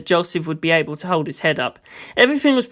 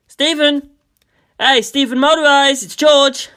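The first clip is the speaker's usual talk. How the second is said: louder, higher shout for a friend; calling out; 8 semitones higher, 6 dB louder, slower than usual. A narrator's reading. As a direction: call up through the big house. Calling through empty manor.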